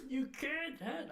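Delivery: high, slow attempt at a movie star's line; strained impression that breaks off; badly faked dramatic film delivery; Jack Nicholson. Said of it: "I can't do it, Jack Nicholson".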